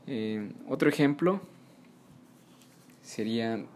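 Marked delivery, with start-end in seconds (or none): none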